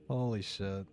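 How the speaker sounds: normal talking voice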